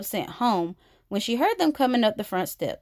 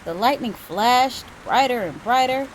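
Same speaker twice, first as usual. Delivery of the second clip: ordinary delivery, nothing marked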